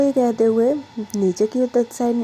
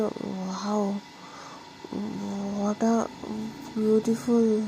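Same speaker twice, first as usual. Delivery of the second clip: much softer, breathless wonder; admiring hush; 8 dB quieter, much slower than usual. Acted. Pragya sees Diksha asleep and murmurs, softly awed. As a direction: let it slip out, awed and soft.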